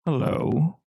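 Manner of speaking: a smooth, decisive greeting; velvet-smooth stranger. Acted. Distinguished man greets the intruder, velvet-smooth. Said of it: "as smooth as velvet".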